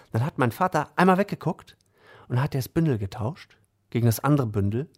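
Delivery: usual speaking voice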